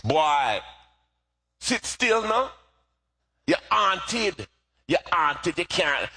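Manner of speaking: high-pitched Jamaican mother scolding; playful Jamaican mom imitation; Jamaican accent. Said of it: "in her Jamaican accent".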